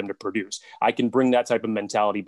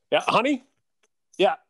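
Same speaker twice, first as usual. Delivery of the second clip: high, sugary "yes dear" answer; sweet reply to wife; 10 semitones higher, 5 dB louder than usual. Acted. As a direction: turn sweetly high for your honey. Coach answers wife mid-interview.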